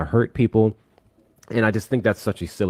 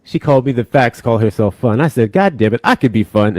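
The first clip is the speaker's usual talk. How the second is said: loud, raised retelling of the slight; loud mock grievance; 7 semitones higher, 7 dB louder than usual. Real, not acted. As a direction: air the grievance loudly, playfully. Retelling sister's slight, loudly.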